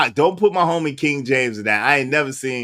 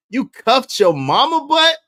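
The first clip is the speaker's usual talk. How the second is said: disbelieving; 9 semitones higher, 5 dB louder than usual. Real, not acted. Repeats clip in disbelief.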